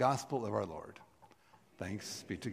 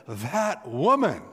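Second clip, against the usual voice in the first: "That woman," slow, louder and higher, full of delight; Adam swooning over Eve, "That woman,"; 5 semitones higher, 7 dB louder, slower than usual. Pretend. Preacher voicing Adam seeing Eve, loud and amazed. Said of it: "he certainly said".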